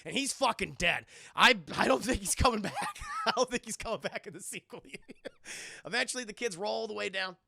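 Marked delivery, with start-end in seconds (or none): 1.3-3.0 loud, rising toward the end; joking emphasis. Real, not acted. Joking about character's death. 3.1-5.8 words breaking up in laughter; real giggling at own joke; laughing. Real, not acted.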